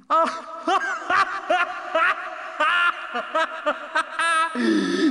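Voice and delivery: high, loud, long belly laugh; roaring laugh. Acted. Dragon laughs at the little son, roaring laugh. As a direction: roar with helpless laughter. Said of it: "He roared".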